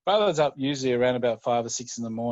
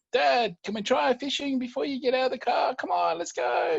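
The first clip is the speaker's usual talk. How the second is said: put-on high, shouting child begging his dad; excited little boy; 14 semitones higher, 3 dB louder than usual. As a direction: call out like an eager young kid. Son begging dad in story.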